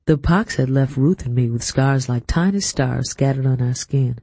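ordinary delivery

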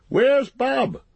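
high, loud questioning call; brisk landlord's demand. Acted. Landlord to his servant, demanding.

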